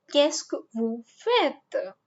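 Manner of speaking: slow, careful qu'est-ce que vous faites; slow model phrase. Shown as a demonstration. Teacher modelling a formal question slowly. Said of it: "Qu'est-ce que will help you".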